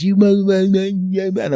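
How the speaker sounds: high, loud mimicked telling-off; mock angry rant. Furious lady tells off the man behind her, mock angry. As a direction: launch into an indignant, garbled rant.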